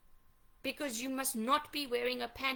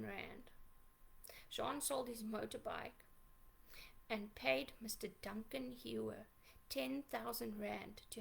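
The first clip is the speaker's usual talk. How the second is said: much softer, partly whispered, real hurt held down; hushed bitter account; 3 semitones lower, 8 dB quieter than usual. Real, not acted. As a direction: say it low, almost under your breath. Hushed account of lawyer betrayal.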